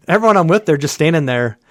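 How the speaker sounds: normal talking voice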